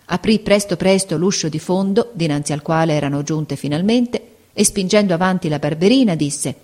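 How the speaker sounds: ordinary delivery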